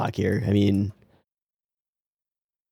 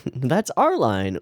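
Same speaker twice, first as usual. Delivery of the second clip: usual speaking voice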